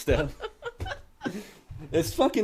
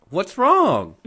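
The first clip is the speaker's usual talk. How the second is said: high, loud "what's wrong?"; raised, louder mimicry; mock pestering sister; 8 semitones higher, 8 dB louder than usual. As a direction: imitate a sister needling with the question. Sister repeats question, irritatingly.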